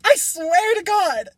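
high, drawn-out wail; high-pitched, slowing cry of exasperation; exasperated outburst. Real, not acted. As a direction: wail it at a friend in disbelief. Friend's exasperated outburst at story.

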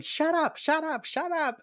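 hammed-up shouting at a cellmate; mock yelling. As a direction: yell at her to shut up. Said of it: "The other woman's going".

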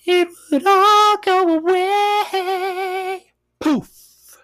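very high and slow, drawn out; dreamy wish. Pretend. Vlogger wishing politics away, high and dreamy. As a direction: float the words up like a wish.

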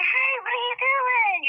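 high, slow, loud call out; a teen calling out across the room, loud. Retold or imitated. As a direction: call her out loudly. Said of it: "a little bit loud".

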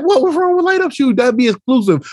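high-pitched playful defence; mock protest. Teacher teases student about light-up shoes, mock protest. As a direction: pitch it up, teasing and insistent.

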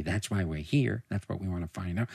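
low, soft mocking imitation; slimy boyfriend. Slimy boyfriend answering about marriage, low and soft. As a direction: echo him quietly, dropped low and slimy. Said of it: "Oh, he's so slimy".

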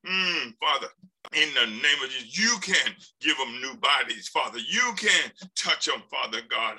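earnest healing prayer; urgent prayer over the sick. Real, not acted. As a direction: plead for her body to heal. Prays for healing.

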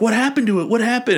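high, panicked hobbit cry; sudden panic over lost bread; alarmed. Retold or imitated. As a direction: squeak out the alarm.

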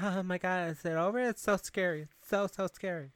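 high, small frightened-girl imitation; mock scared girl. Girl in theater watching stabbing, mock scared. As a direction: whimper lightly in a put-on high pitch.

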